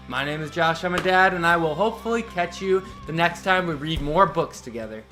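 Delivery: ordinary delivery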